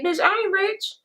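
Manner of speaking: high, soft put-on voice; squeaky mock admission; mock blunt voice. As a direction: play it as a sassy imitation. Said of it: "humble enough to say".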